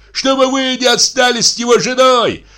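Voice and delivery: very high, squealing voice; squeaky tattling. A narrator's reading. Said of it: "запищал Михей Егорыч". (пискливым, высоким голосом)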